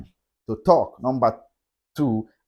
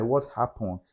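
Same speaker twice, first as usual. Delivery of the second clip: normal talking voice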